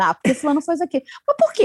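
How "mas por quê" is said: high, pressing why; probing why. Shown as a demonstration. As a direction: push the question up high. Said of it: "exercício do porquê". (pergunta insistente e aguda)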